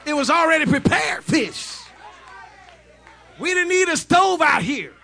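high, loud shouted sermon; fiery revival preacher, shouting the climax; full-force preaching. Acted. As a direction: preach it out to the crowd at the top of your voice.

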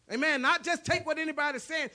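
loud, high, ringing pulpit preaching; impassioned revival sermon voice; full-force preaching. Acted. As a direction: preach it out to the whole congregation.

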